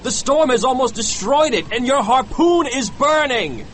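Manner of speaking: high, alarmed warning; urgent, frightened cry in character. A narrator's reading. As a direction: shout the danger over a storm. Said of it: "cried Starbuck".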